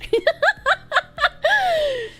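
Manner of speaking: high-pitched giggling laugh; high giggle. Real, not acted. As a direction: let out a squeaky giggle. Laugh after joke.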